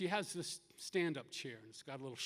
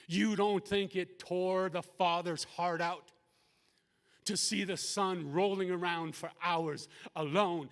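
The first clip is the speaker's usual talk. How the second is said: loud, raised preacher's voice; impassioned preaching; 3 semitones higher, 8 dB louder, slower than usual. Real, not acted. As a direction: press the point home with full force. Preaches at full force.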